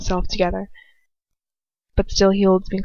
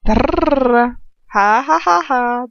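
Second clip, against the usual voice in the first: laughing at a flub; 5 semitones higher, 4 dB louder, much slower than usual. Real, not acted. Reader stumbles and laughs.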